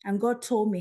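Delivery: ordinary delivery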